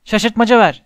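Harry calling for a distraction, loud and urgent; desperate call. A narrator's reading. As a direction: shout it out in desperation. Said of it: "Harry çaresizlik içinde".

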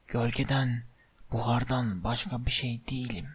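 low, hushed, menacing voice; sinister whispering villain. A narrator's reading. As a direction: murmur it darkly, barely above a whisper. Villain's long whispered monologue. (alçak, fısıltılı, tehditkâr ses)

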